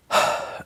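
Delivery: soft fed-up sigh; exasperated teacher's sigh; weary sigh. Shown as a demonstration. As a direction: sigh out your lost patience. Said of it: "you're fed up".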